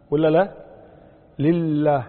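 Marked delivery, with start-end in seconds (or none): 1.4-2.0 لله with the doubled lam held; shadda demonstration. Shown as a demonstration. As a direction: lean firmly on the double L in لله. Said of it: "هذه واحدة".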